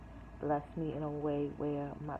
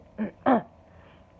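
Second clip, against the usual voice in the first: loud harsh cough; hacking cough; 5 semitones higher, 14 dB louder than usual. Real, not acted. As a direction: cough it out hard. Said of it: "So this is what I mean".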